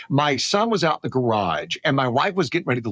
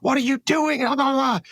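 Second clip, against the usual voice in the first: re-enacted outburst, pitched way up; retold yelling; 11 semitones higher than usual. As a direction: imitate an alarmed dad yelling. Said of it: "I could hear you yelling".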